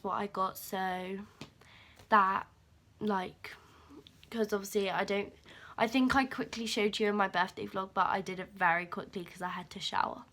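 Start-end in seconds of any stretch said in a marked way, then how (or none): none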